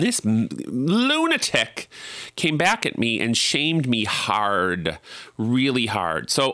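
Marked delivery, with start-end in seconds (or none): 0.0-1.9 outraged. Real, not acted. Outraged at the shaming woman.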